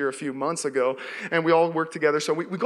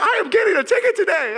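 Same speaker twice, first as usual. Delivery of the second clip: much higher, louder mock despair; hammed-up panic; 14 semitones higher, 6 dB louder than usual. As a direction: wail it like doom is certain. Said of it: "So I start freaking out".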